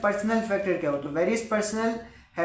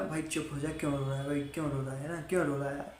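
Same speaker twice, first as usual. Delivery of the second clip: soft, lower comforting voice, much quieter; soothing hush; 5 semitones lower, 7 dB quieter, faster than usual. Retold or imitated. Friend consoling someone he thinks is crying, soft and low. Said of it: "कोई आके बोलने लगा".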